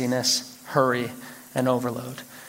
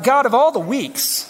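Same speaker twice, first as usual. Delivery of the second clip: raised, exasperated complaint to God, half laughing; mock frustrated prayer; 5 semitones higher than usual. Pastor retelling his complaint to God, mock exasperated. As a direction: whine at God through a laugh. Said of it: "And I'm frustrated".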